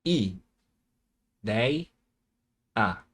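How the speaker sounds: "I, dei, a" with a long pause after each syllable. Shown as a demonstration. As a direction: separate it like a teacher: "I", "dei", "a". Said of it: "Vamos separar em sílabas".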